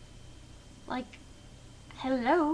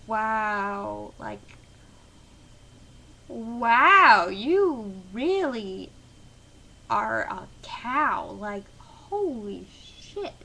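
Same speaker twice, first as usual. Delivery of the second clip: stunned disbelief; 6 dB louder, much slower than usual. Retold or imitated. Her own stunned reaction.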